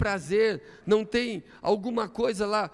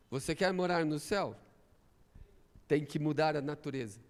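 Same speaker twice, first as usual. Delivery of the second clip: quiet challenge; 6 semitones lower, 6 dB quieter than usual. Real, not acted. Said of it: "repeated challenge, first take".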